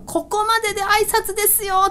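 high, emphatic imitation of an expert greeter; hammed-up, lifted proclamation; clear-cut announcing. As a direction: declare it as an official greeting. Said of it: "っていう風に完結させてくるんですよね".

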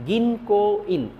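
গিনকো ইন said slowly and clearly; slow model word. Shown as a demonstration. Teacher modelling গিনকো ইন slowly for students. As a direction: drill গিনকো ইন for the class. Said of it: "এরপরে".

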